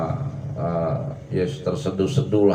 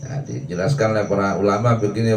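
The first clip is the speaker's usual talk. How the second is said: ordinary talk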